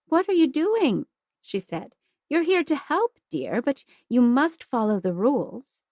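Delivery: high, firm nun's voice; stern kindly nun. Acted. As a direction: gently scold as a nun. Voices Sister Agnes in story.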